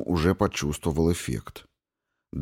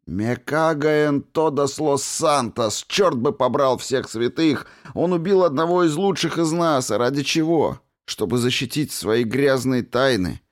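much higher, furious cursing; angry outburst; 7 semitones higher than usual. Acted. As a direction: rage spilling over grief. Grieving friend bursts into cursing.

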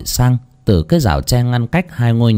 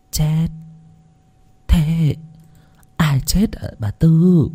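lightly voiced elderly bewilderment, soft and higher; a hint of a frail old lady, confused; puzzled old woman; 6 semitones higher, 3 dB quieter, slower than usual. A narrator's reading. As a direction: ask slowly, not quite following.

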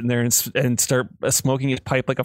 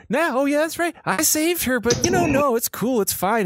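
much higher, mock-cheery sarcasm; sarcastic sulk; 12 semitones higher than usual. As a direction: sneer it as if it were fine. Silver's sarcastic sulking rant.

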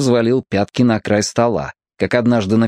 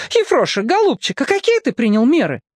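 sweetly coaxing; 13 semitones higher than usual. A narrator's reading. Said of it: "сразу переключилась".

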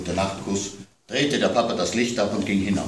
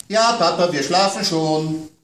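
put-on higher, childlike complaint; child's voice worried about monsters at bedtime; little girl; 10 semitones higher, 6 dB louder, slower than usual. A narrator's reading. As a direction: play the small daughter scared of monsters.

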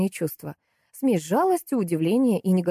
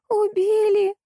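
very high, drawn-out lament; moaning wail; 4 dB louder, much slower than usual. A narrator's reading. Salesgirl moans from under the counter, high wail. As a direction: wail it out in agony on one high note. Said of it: "стонала из-под прилавка девушка".

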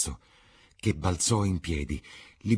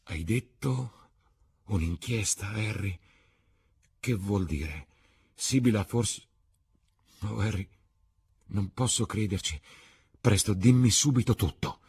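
barely holding back the sobs; choked sobbing; 5 semitones higher than usual. A narrator's reading. Dorian asks Harry about the inquest with a choked voice. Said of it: "singhiozzò con voce soffocata".

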